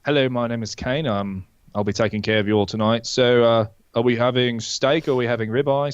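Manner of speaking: everyday British waiter voice; unfussy British accent, measured greeting; regular British accent. Shown as a demonstration. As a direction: take the order in standard British. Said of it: "There's the just regular".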